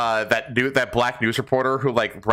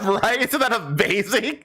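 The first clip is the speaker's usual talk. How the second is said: laughing; 9 semitones higher than usual. Real, not acted. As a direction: laugh in amazement. Laughing after the clip.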